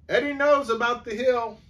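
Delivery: put-on a kid blurting out news; excited line. A narrator's reading. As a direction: blurt it out eagerly.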